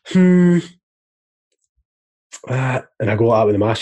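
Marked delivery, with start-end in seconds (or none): 0.1-0.6 loud, high doubtful hum; a suspicious cashier's hum; sceptical hmm. Retold or imitated. Imitating the shop guy eyeing his ID, a loud high hmm. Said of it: "he's like".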